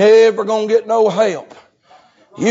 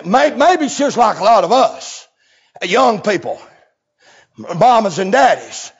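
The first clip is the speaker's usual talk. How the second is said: usual speaking voice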